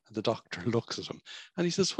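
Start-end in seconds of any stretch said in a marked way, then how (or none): none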